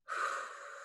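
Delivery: soft, airy exhale; demonstrated sigh. Shown as a demonstration. Teacher showing how to sink into the body, soft sighing exhale. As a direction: let out a soft settling breath.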